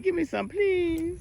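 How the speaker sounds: high, slow pretend animal plea; pleading animal. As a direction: beg on the animal's behalf, drawing out the words. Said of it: "He said".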